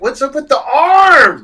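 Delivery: high, loud incredulous yell; hammed-up reaction, high and loud; mock shout. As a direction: shout your disbelief. Own retold reaction.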